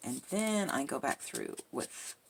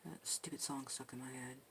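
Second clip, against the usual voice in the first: soft, much quieter; awfully quiet; 3 semitones lower, 10 dB quieter than usual. Real, not acted. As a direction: keep it down, near a murmur. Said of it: "I'm being awfully quiet".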